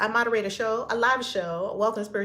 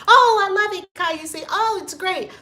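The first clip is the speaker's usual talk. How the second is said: high, loud mock fan gushing; mock viewer; 10 semitones higher, 7 dB louder than usual. Mimicking viewers who praise but do nothing. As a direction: gush like a fan who won't act. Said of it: "They watch the videos".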